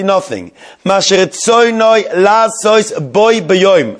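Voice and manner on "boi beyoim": boi beyoim drawn out slowly and leaned on; slow down and press on boi beyoim; emphasis on boi beyoim. Shown as a demonstration. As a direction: spell out boi beyoim word by word. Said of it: "I'd like to emphasize the word boi beyoim".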